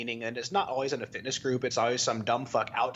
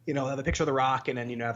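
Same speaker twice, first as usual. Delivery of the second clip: ordinary talk, nothing marked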